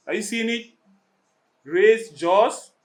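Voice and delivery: ordinary talk, nothing marked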